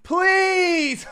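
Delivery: high pleading yell; shouting. Real, not acted. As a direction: shout it, begging them to stop. Yelling at opponent.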